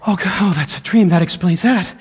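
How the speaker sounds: acting breathless on waking; out of breath. Preacher relives waking from a nightmare, out of breath. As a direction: catch your breath as if just woken. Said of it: "And I'm out of breath".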